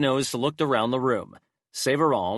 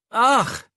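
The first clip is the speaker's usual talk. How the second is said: exasperated cry; 13 semitones higher, 6 dB louder than usual. Acted. Hypnos exclaims in exasperation.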